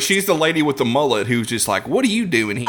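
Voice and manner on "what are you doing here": put-on light New Zealand accent. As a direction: attempt a Kiwi accent. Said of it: "trying to do a New Zealand accent".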